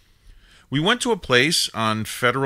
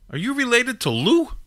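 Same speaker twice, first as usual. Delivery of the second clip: surprised mobster; 14 semitones higher, 3 dB louder than usual. Retold or imitated. Mobster asks about grandfather.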